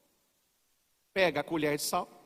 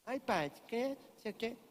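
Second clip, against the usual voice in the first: much softer, dismissive penitent voice; mock penitent murmur; 3 semitones higher, 12 dB quieter than usual. Penitent brushing off the priest in confession, soft murmur. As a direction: brush it off under your breath.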